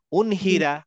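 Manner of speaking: উনহিরা with a clear n before h; clear noon. Shown as a demonstration. Teacher contrasting izhar for the student. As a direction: say উনহিরা crisply, n fully sounded. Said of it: "তখন বলতাম".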